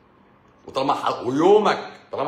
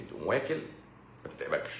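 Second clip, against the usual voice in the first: usual speaking voice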